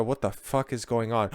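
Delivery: normal talking voice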